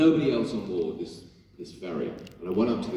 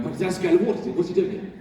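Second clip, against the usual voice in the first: higher, louder excited fan voice; imitating fans; 9 semitones higher, 5 dB louder, faster than usual. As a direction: play a starstruck fan spotting him. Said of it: "you can see people going".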